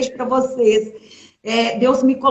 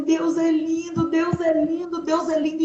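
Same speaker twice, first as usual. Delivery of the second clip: tearful praise; 5 semitones higher, slower than usual. Retold or imitated. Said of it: "e chorando".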